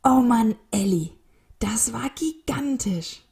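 high, elated exclamation; overjoyed, stretching out the last words; happy. A narrator's reading. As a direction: marvel gratefully at a friend's feat. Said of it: "sagte Alfred glücklich".

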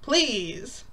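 begging. Retold or imitated. Woman begs him to stop licking her, pleading. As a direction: plead insistently, stressing each please. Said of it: "you're begging me then".

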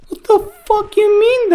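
high incredulous outburst; shocked. Real, not acted. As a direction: blurt it out in disbelief. Shocked at game reveal.

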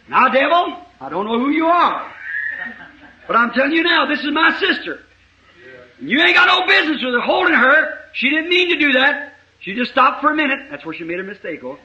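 high, loud commanding rebuke; rebuking the devil. Retold or imitated. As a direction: order the devil out with rising force. Rebuking a devil in a retold exorcism.

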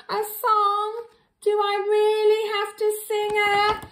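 high whiny reluctance; mock dismay. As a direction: whine about having to sing. Reader dreads singing.

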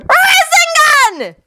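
long, high battle cry; high-pitched drawn-out yell; shouted attack. A narrator's reading. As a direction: stretch the attack name into a squealed shout. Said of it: "Naruto shouted out".

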